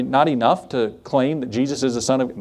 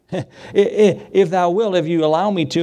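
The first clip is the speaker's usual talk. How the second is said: nervous stammer; 4 semitones higher than usual. Acted. Peter stammering the request.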